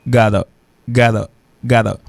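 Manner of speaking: gotta said as "GAH-duh", each one set apart; gotta, open first vowel, tapped middle; first pronunciation demo. Shown as a demonstration. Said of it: "et puis il prononce".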